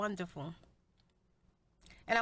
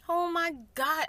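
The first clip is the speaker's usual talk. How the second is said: emotional outburst; 7 semitones higher, 6 dB louder than usual. Real, not acted. Overcome with emotion mid-story.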